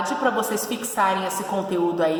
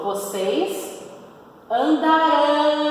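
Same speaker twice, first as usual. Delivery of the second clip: "andarão" drawn out, "ão" stressed and higher; stress on the ending ão; 5 semitones higher, much slower than usual. Shown as a demonstration. Teacher stressing the future ending ão for pupils. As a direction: land on the "ão" of "andarão". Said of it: "Olha só, o ão".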